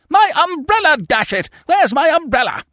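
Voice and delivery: very high, loud interruption; squawking, outraged young club man; exasperated outburst. Acted. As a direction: cut in, furious at being put off. Youth interrupts in fury.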